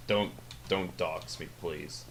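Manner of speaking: ordinary talk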